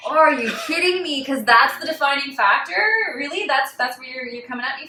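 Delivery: loud, high-pitched incredulous retort; retold outraged questioning; indignant disbelief. Retold indignant reply to official.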